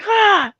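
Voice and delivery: loud, high startled scream; high yelp scream. Retold or imitated. Counselor lunging at tarantula with a bucket, high yelp. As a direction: let out a sharp frightened yell.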